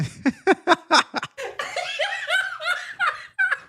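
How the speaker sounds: long, high-pitched laughter; real laughing fit. Real, not acted. Reacting to a joke.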